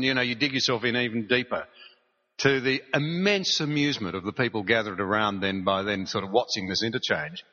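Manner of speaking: ordinary delivery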